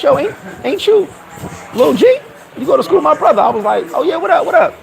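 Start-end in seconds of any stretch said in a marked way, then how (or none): 0.0-3.4 high, puzzled double-take; high-voiced questioning, acted out; imitated surprised recognition. Producer recognizes him in club. 3.9-4.7 high, quick retold hello; imitated upbeat acknowledgement; acted casual greeting. His retold greeting to producer.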